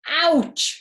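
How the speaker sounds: high, loud yelp of pain. Acted. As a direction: yelp as the baton hits his toe. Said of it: "he yelled".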